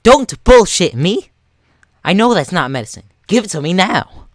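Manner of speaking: high, loud angry shout; raising voice. Acted. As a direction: yell it at the kid, furious and demanding. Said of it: "raising voice".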